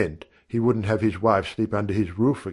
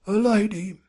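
Hawken's high voice; 11 semitones higher than usual. A narrator's reading. Hawken repeats the announcement.

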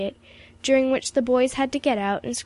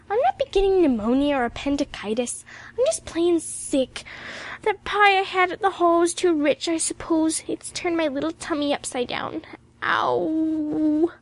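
high, whiny invalid voice; sick girl's complaint; 6 semitones higher than usual.

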